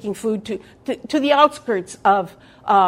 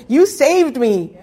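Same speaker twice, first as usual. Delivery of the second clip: laughing; 3 semitones higher, slower than usual. Real, not acted. Laughing while addressing God.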